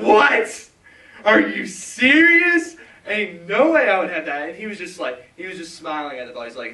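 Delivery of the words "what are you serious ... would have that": very loud, high-pitched disbelief; incredulous outburst. Retold or imitated. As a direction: shout it in utter disbelief, reenacting your shock. Reenacts his disbelief at pastor's forgiveness.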